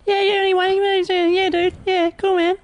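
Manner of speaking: very high, squeaky mock voice; hammed-up falsetto caricature of himself; people impersonating him. Comedian mimicking people doing an impression of him, high caricature. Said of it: "That's people doing an impression of me".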